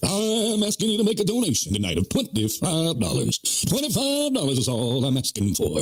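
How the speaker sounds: milk the donation appeal like a hammed-up evangelist; mock preacher.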